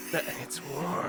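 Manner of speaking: hushed mock-dramatic game line; imitated Goku line. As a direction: draw out the words in imitation. Imitating Goku's quoted line.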